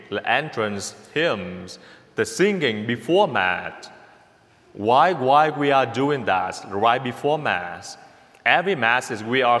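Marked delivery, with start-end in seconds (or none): none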